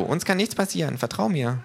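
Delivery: lightly voiced kangaroo, calm and sure. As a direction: reassure him in the kangaroo's voice. Kangaroo voice reassuring.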